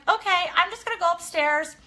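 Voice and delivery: acting normal while scared; fake casual. Nervous excuse to leave.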